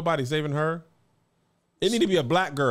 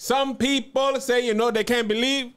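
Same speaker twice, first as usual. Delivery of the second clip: Jamaican accent; 6 semitones higher than usual. Shown as a demonstration. Podcast host defending the accent work, hammed-up Jamaican accent. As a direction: show off a big Jamaican accent. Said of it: "get louder with your accent".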